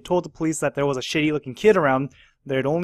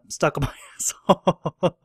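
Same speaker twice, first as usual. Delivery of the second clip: laughing; 2 semitones higher, slower than usual. Real, not acted. Laughing mid-sentence at crude line.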